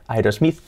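"Aerosmith" as ai-ros-MIZ, questioning rise; Spanish-style ai-ros-MITH; Spanish pronunciation. Shown as a demonstration. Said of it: "no nos van a entender".

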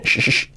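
"sh" as separate hushing bursts; SH sound. Shown as a demonstration. Teacher isolates the sound for learners, repeated "sh". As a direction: repeat a short hushed "sh". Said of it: "La S H".

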